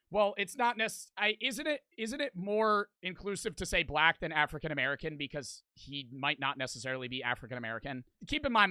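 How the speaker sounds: ordinary talk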